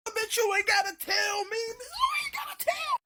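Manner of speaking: high, loud panicked yelling; panicked shout. Retold or imitated. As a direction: scream it as you run out in panic. Fleeing the shop in panic.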